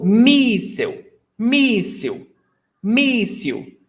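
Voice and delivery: míssil with a high, drawn-out MÍS; míssil repeated as MÍÍS-sil, front-stressed; stress before last. Shown as a demonstration. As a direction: stretch the first syllable of míssil high, then drop. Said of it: "the stressed syllable is before last".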